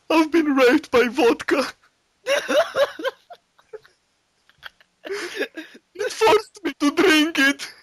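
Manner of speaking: high, slow fake sob; mock crying. Boris on TV, high, slow and fake sobbing. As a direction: wail like a broken victim. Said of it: "he's like".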